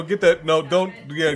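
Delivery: normal talking voice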